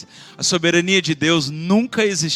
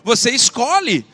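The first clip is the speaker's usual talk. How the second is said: loud, driving sermon voice; full-force preaching; 5 dB louder than usual. Acted. Pastor urging his congregation in a loud preaching voice.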